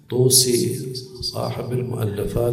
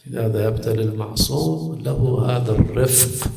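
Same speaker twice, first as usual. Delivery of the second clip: usual speaking voice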